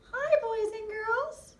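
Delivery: high, slow kitty puppet voice. Pretend. Cat puppet greeting the boys and girls.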